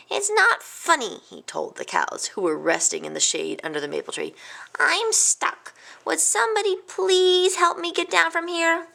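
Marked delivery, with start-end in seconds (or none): 0.1-1.2 high, slow, put-out whine; indignant complaint. A narrator's reading. Stuck Hamlet complains to cows. 4.8-8.9 high-pitched, helpless request; plaintive plea. A narrator's reading. Hamlet pleads while stuck.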